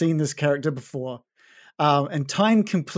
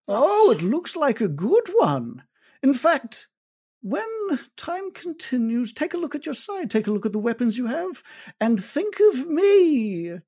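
higher, sly cat-folk voice; sly tabaxi; 10 semitones higher than usual. Acted. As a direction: play the tabaxi trader, knowing and light. Needle reveals reward.

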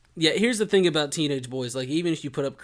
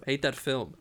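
normal talking voice

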